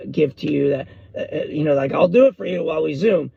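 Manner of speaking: ordinary talk, nothing marked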